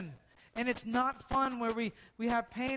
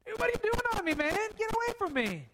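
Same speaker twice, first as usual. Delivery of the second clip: put-on high, casual teen voice, much higher; boy pushing the prophet off, high and bewildered; startled boy; 11 semitones higher, faster than usual. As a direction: squeal like a kid waking up confused. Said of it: "he must have been like".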